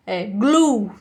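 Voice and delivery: glue ending firmly on a strong U; strong final U. Shown as a demonstration. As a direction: end glue on the U, nothing after. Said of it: "Termina aqui com o U bem forte".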